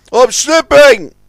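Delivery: high, loud and slow; alarmed cry. A narrator's reading. The crane slides toward the edge, alarmed. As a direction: stretch the cry out in fear. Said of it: "cried Scuttlebutt".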